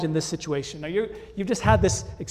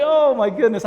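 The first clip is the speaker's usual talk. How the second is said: higher, much louder exclamation; loud dismay; 6 semitones higher, 10 dB louder than usual. Retold or imitated. Dad losing the battle with his son, loud dismay. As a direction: cry out in mock defeat.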